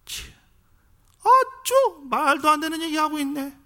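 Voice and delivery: the scribes scoff at Jesus's parables in a high, loud sneer. Retold or imitated. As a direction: scoff at it like a scribe who thinks it's nonsense. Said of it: "비웃었다고 그랬어요".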